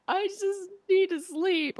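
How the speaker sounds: worn-out mom begging for sleep, slow and high; exhausted mom. Retold or imitated.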